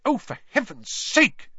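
high-pitched burst of irritation; cross, high exclamation; exasperated snap. A narrator's reading. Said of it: "she snapped".

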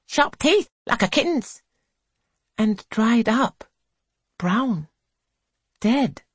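put-on high, eager girlish voices; young girls telling a thrilling tale; excited girls. A narrator's reading. Girls describe the mermaid.

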